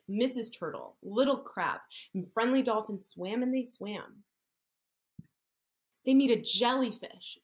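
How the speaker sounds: usual speaking voice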